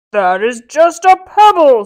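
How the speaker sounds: loud scornful giant. Acted. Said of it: "said the giant".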